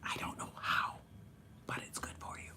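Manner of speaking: whispered aside; soft unvoiced whisper. Real, not acted. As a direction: whisper it as a secret.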